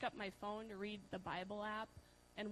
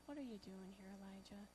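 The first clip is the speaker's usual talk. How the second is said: softer, gentle voicing of God's question; still small voice; 3 semitones lower, 10 dB quieter, faster than usual. Preacher voicing God gently asking Elijah, soft and quiet. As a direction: ask it softly, like a still small voice. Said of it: "God came in a whisper, in a still, small voice".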